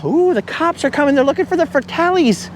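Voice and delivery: high, squeaky mock alarm; hammed-up high voice of a nervous bystander; mock panicked beachgoer. Voicing beachgoers spotting cops.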